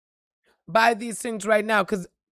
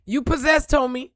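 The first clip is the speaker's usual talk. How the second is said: preaching shout; 5 semitones higher, 6 dB louder than usual. Real, not acted. Preacher shouting at his viewers.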